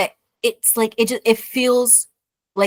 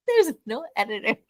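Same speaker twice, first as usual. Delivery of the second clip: ordinary talk, nothing marked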